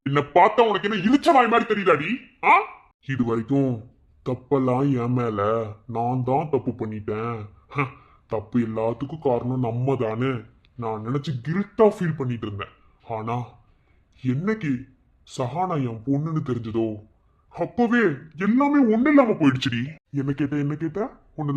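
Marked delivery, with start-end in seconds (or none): 0.1-2.5 higher, louder scornful question; furious taunting question; angry shout. Acted. Enraged husband. 17.5-19.8 high, loud bitter shout; enraged cry; furious outburst. Acted. Rage breaks out.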